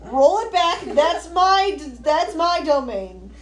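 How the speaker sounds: loud, high mock indignation; loud protest. Real, not acted. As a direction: shout it down, half joking. Protesting friend's dragon joke.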